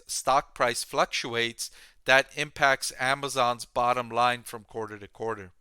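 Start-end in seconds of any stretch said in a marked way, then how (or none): none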